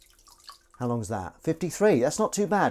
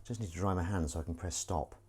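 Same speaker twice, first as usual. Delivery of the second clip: ordinary talk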